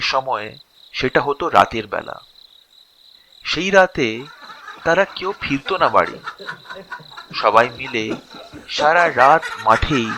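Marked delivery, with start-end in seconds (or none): none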